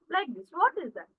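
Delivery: ordinary delivery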